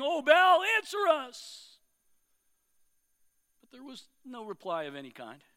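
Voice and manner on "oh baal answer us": high, loud cry to Baal; mock shout. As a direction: plead to a silent god, up high.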